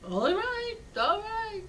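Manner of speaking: very high, rising sing-song agreement; acted compliance. Villager agreeing to put goat outside, acted compliance. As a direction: give in with a high-pitched, humoring tone.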